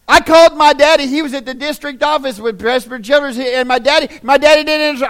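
very loud, high-pitched preacher's shout; full-force preaching. Real, not acted. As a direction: holler it at the congregation, driving the repetitions home.

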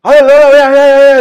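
very loud, high, drawn-out mock yell; imitated screaming. Teller imitating coworkers yelling past the cubicle wall, mock screaming. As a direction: holler it out high and long. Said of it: "they start screaming again".

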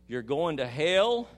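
loud, with a drawn-out HELL; bold retold pronouncement. Minister retelling a message to the governor, loud pronouncement. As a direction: deliver the message slowly and forcefully.

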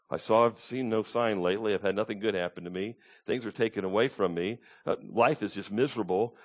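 lightly voiced weary complaint; discouraged believer. Preacher voicing a believer who sees nothing good, mildly discouraged.

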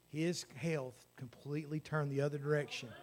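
usual speaking voice

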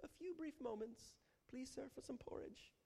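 very high, soft orphan begging voice; pitiful beggar. Acted. Preacher mocking a too-polite prayer as a beggar. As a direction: beg like a waif asking for porridge. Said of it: "a little more polite".

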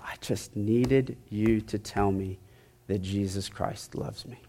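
soft, slow retelling of a tearful confession; retold tearful plea. Surgical technician confessing to the preacher, retold softly, tearful. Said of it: "just bawling".

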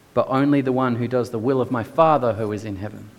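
ordinary talk, nothing marked

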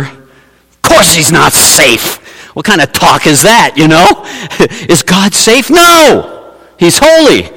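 lightly voiced beaver, slow then rising; a hint of an indignant animal; mock indignant beaver voice; gruff Beaver. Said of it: "Mister Beaver".

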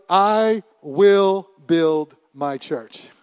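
very slow, drawn-out and loud; preaching proclamation. Acted. Jesus declaring to the disciples, drawn-out proclamation. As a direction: stretch every word as a decree.

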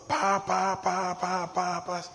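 rhythmic, level "pa pa pa" noise; imitated racing thoughts. Preacher imitating his busy morning mind, repeated sound. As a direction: drum out the pounding thoughts on one note. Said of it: "así, hermano".

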